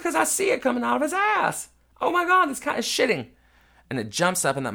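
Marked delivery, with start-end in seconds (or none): 0.0-3.3 much higher and louder yell; panicked yelling. Retold or imitated. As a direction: shout it in disgusted panic.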